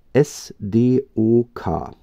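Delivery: puzzling over "S D O K" letter by letter; unsure spelling-out. Real, not acted. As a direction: sound out "S D O K" slowly, each letter separate. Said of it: "keine Ahnung, wie man das richtig ausspricht".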